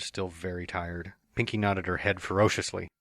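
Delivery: usual speaking voice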